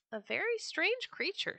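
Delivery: high-pitched cat character voice; a cat appraising a stranger in high tones; storybook cat. A narrator's reading. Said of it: "said the cat".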